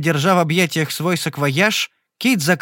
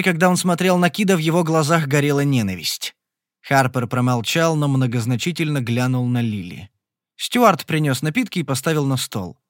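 plain reading voice

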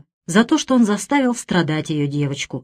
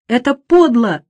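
louder tearful cry of outrage; sobbing, louder repeat; 4 semitones higher, 4 dB louder than usual. A narrator's reading. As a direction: repeat it louder through tears. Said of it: "Джинни рыдала и повторяла".

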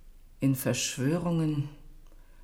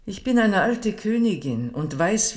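raised voice; 8 semitones higher, 5 dB louder than usual. Acted. Queen lectures the ambassador.

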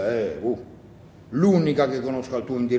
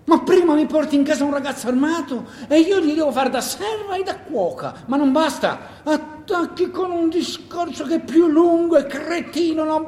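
exasperated wife, much higher voice, laughing in disbelief; scolding wife; 11 semitones higher, 4 dB louder than usual. Acted. Wife berating her husband for his foolish speech, higher, exasperated. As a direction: tear into him, half laughing, half furious.

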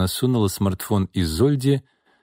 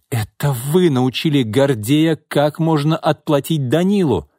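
higher-pitched, heated accusation; accusing; 6 semitones higher than usual. A narrator's reading. Secretary exposes her boss's scheme, accusing. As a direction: press each charge home.

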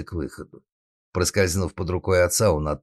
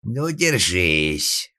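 slow, drawn-out growled threat; menacing growl; 2 semitones higher, 3 dB louder, much slower than usual. Acted. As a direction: growl a warning of the beating to come. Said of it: "прорычал отец".

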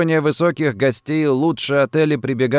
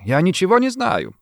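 higher, fearful denial; frightened babble; 6 semitones higher than usual. A narrator's reading. Rossetti denies knowing of the plot, fearfully babbling. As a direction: plead ignorance in a raised pitch. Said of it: "пролепетал белыми губами Рассетти".

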